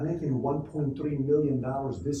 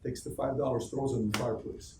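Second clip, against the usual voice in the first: ordinary delivery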